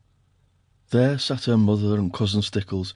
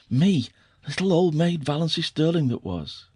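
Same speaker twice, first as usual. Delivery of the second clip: higher, delighted self-mocking wonder; gleeful; 6 semitones higher than usual. A narrator's reading. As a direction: savour the triumph. Gleeful private thought.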